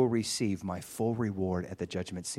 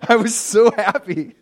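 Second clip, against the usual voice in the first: laughing happily; 10 semitones higher, 11 dB louder than usual. Real, not acted. Preacher recalling his shout to the kids, laughing. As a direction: laugh through the words, higher and louder.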